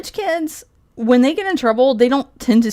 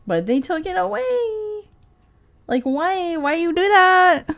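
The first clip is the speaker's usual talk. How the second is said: slow, higher-pitched pleading whine; mock lament; 6 semitones higher than usual. Streamer begging for the shrimp po' boy back, mock wailing. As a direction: plead with hammed-up heartbreak.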